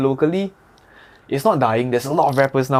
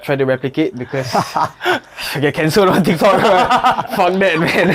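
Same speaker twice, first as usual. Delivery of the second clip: laughing through the words, louder and higher; 5 semitones higher, 5 dB louder than usual. Real, not acted. Laughing off the risk of getting cancelled on TikTok. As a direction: giggle it off.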